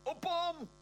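shrill panicked scream, very high; high-pitched scream. Acted. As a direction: scream it in terror. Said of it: "high-pitched scream".

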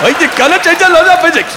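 garbled, high and loud mock Scottish accent; Scotty. Comic mocking Star Trek's engineer, incomprehensible Scottish accent. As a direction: mangle a Scottish accent until nobody can understand it. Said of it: "Scotty in Star Trek was the only one we couldn't understand".

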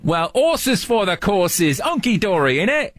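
slow, put-on British slang; British accent. Shown as a demonstration. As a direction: roll out the Brit phrases slowly. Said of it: "since we're on British terminology".

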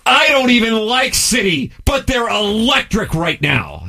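high-pitched mock yelling of a text; all-caps screaming. Host reading out a listener's all-caps text, mock screaming. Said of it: "so he's screaming at us".

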